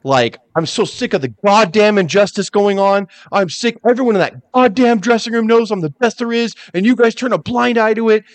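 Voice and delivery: a fan re-enacting Brett's furious promo, heated and rising; imitating an angry rant. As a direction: rant like a wrestler who's had enough. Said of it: "started cussing".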